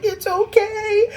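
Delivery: high slow soothing voice; mock tender high voice; comforting girl. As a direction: coo it like a girlfriend. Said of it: "the girl's like".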